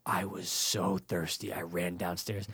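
low, very soft dramatic confession; quiet, intense retelling; hushed storytelling. Retold or imitated. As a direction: drop right down to tell how desperate it was. Dramatically retelling waking up parched.

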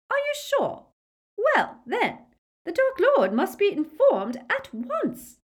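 very high, loud, urgent woman; high-pitched zealous follower; imperious Bellatrix. A narrator's reading. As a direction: issue orders in a high excited voice.

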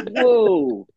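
a hammed-up gasp of surprise; mock surprise.

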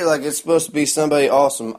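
ordinary delivery

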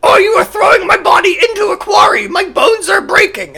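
very high, loud puppet squeal; mock Grover voice. Grover complaining as he is crushed, mock puppet wail. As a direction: wail in a high-pitched Muppet voice. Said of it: "He'd be like".

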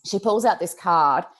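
ordinary delivery

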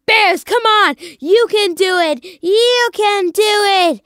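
loud, high rallying shout; pleading fan cheer. Retold or imitated. Fan willing the Bears on in overtime, urgent cheering. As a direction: urge the team on, drawing out the last plea. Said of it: "you're sitting there and you're like".

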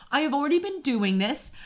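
high, loud tirade, as if losing it on a call; retold angry yelling. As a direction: imitate screaming at customer service. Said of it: "I screamed at the man".

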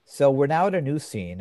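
normal talking voice

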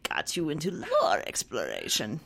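a hint of a witch; witchy voice. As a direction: croak it like a weird witch. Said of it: "Now I'm just going weird witchy voice".

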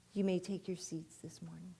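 ordinary talk

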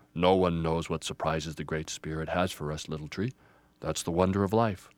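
low elder tree voice; gentle wise Grandfather. Acted. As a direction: counsel with calm gravity. Grandfather counsels.

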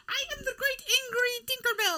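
high, loud, hammy angry Tinkerbell; mock grand proclamation. Friend jokes she looks like Tinkerbell, loudly proclaims herself great. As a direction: declare it like a pompous fairy.

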